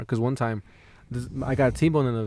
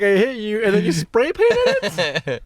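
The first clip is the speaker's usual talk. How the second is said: mock outrage; 9 semitones higher, 4 dB louder than usual. Mock outraged retort to driver.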